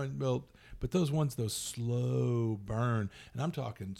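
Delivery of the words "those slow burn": "slow" stretched way out; drawn-out SLOW. Shown as a demonstration. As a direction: drag the word "slow" to mimic slowness. Drawing out slow to mimic it.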